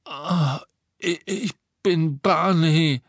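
pained, thick-tongued stammer; mouth full of blood. A narrator's reading. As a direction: stammer high and slow through a bloody mouth. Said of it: "dass sein Mund voller Blut war".

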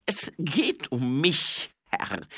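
high, slow, loud admission; embarrassed subordinate. Acted. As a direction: own up haltingly to a superior. Grinsi confesses awkwardly to superior. (verlegene, hohe Untergebene)

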